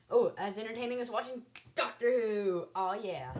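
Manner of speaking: loud, high sarcastic quip; mock it loudly and high. Real, not acted. Sarcastic joke with laugh.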